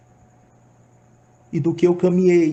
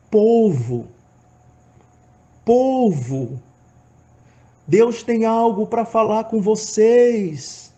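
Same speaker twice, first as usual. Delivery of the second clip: shouted call; 7 semitones higher, 4 dB louder, much slower than usual. Retold or imitated. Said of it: "eu começava a gritar".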